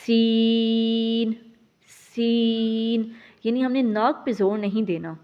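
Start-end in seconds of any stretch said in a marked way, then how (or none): 0.1-3.0 سین stretched slowly, kept out of the nose; correct sin. Shown as a demonstration. Teacher models correct سین again. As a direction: clear drawn-out سین, not nasal. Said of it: "کیسے پڑھنا ہے؟".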